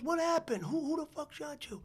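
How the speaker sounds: high urgent questions; alarmed cop. Retold or imitated. Cop finds the shot kid, high urgent alarm. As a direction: ask it in alarm at the wounded kid. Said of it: "he goes, he goes".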